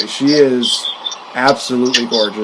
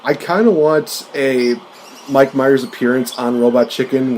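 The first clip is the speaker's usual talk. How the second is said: ordinary delivery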